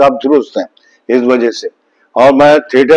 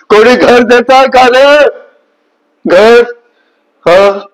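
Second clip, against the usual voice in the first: Marathi dialogue; 4 semitones higher than usual. Shown as a demonstration. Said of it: "आप direct सुनाओ". (Marathi dialogue, ऊँची धीमी नाटकीय आवाज़ में)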